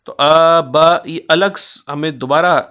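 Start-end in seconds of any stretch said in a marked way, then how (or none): 0.1-1.0 "आ बा" as two loud separate pushes; separate breaths. Shown as a demonstration. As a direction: break "आ" and "बा" apart with a fresh breath. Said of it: "जैसे आ के बाद बा कहेंगे". ("आ बा" को अलग-अलग ज़ोर से बोलना)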